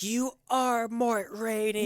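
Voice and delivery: high, slow, dazed chant of the name; drawn out like a stunned woman; dazed victim repeating. Retold or imitated. Wife lying hurt on the ground, slow high dazed voice. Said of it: "she's like".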